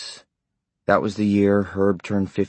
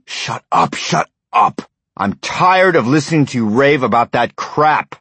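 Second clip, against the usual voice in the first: furious, much higher and louder shouting; angry yelling; 7 semitones higher, 5 dB louder than usual. A narrator's reading. Husband yelling at his wife to stop raving, furious. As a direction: yell her down, fed up.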